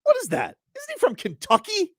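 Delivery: high, incredulous questioning; mock outrage. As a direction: demand an answer in hammed-up disbelief. Scolding a rapper over jacket.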